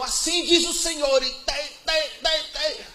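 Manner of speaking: high, loud prophetic proclamation with babbled tongues; hamming up a sister prophesying; mock prophecy. Mocking the sisters who prophesied, high and loud.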